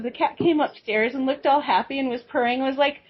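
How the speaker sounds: normal talking voice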